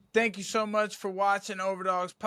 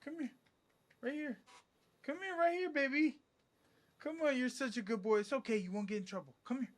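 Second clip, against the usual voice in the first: talking to a dog; 7 semitones higher, 6 dB quieter, faster than usual. Real, not acted. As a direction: sweet-talk a nervous dog into coming. Coaxing dog, reassuring it.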